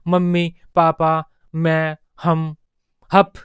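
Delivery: slow, one word at a time; toddler's first words. Shown as a demonstration. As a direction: demonstrate a toddler's words slowly, each set apart. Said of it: "इस तरह से बच्चा बोलने लगता है".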